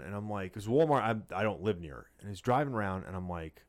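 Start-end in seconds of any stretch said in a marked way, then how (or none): none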